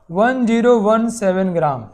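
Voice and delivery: ordinary talk, nothing marked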